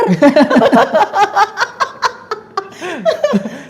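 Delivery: high bursting laugh; loud laugh. Real, not acted. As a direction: laugh out at the memory. Laughing at her memory. (tertawa lepas bernada tinggi)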